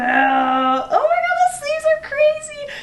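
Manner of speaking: loud, high, drawn-out squeal; big slow gush of delight; excited raving. Real, not acted. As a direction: rave in a soaring, stretched-out voice. Raving over the new dresses.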